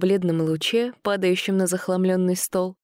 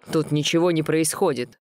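grumbling mutter. A narrator's reading. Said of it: "буркнули в ответ".

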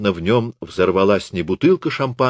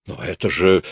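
stammering mutter; 3 semitones lower than usual. A narrator's reading. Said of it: "заикаясь, бормочет Шиммель".

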